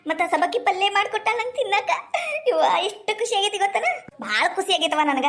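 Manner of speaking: joyful speech through laughs; laughing happily. Acted. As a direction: chuckle while bragging. Happy mother-in-law laughs. (ನಗುತ್ತಾ ಖುಷಿಯಿಂದ)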